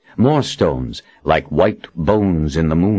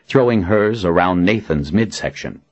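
usual speaking voice

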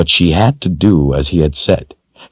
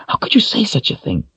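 scandalized woman; 4 dB quieter, faster than usual. A narrator's reading.

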